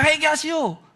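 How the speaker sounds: retold shout. Angry youth shouts a second time, imitated yell. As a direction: call it out high, as a second cry. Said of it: "소리쳤다는 겁니다".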